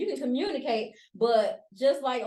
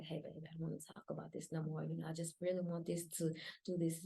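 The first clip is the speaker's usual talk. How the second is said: very soft, low, calm request to a partner; not escalated; 7 semitones lower, 13 dB quieter than usual. Acted. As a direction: keep it hushed and patient. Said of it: "Now I'm not talking like this".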